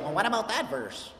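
mock critic voice. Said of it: "none of you have voices like that".

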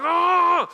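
loud, high acted groan; exasperated yell. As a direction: cry out in mock fury. Acted fury at pouring rain.

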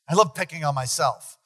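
normal talking voice

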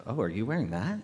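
acted judgmental question, held back; disapproving take. Shown as a demonstration. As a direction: ask it as if you dislike the outfit. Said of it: "big difference, same words".

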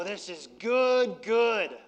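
slow, drawn-out relish; savoring food. Retold or imitated. Pastor imitating himself tasting a Galley Boy, slow and relishing. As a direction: savor each word like a great burger. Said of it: "I would go".

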